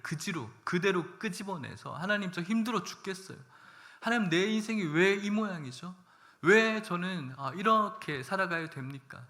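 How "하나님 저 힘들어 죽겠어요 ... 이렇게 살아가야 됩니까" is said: put-on higher, pleading prayer poured out like a child; childlike honest prayer. Believer crying out to God like a child, higher pleading voice. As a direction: spill it all out to God like a child. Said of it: "토해놓는 겁니다".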